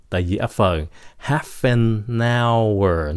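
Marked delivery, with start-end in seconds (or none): 1.3-3.2 "Half an hour" run together, very slow and linked; linked sounds. Shown as a demonstration. Teacher slowly linking "Half an hour" for students. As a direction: link "Half an hour" as one word. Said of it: "nói âm luôn".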